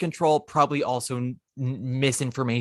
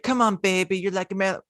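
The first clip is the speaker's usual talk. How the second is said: first try at Liverpool; 7 semitones higher than usual. Retold or imitated. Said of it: "Let me put on my Liverpoolian accent".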